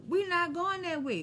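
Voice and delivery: quoted refusal. Retold or imitated. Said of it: "we was like".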